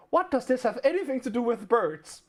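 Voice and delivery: high-pitched retold bafflement, breaking into laughter; mock-bewildered question, laughing at the end; baffled retort.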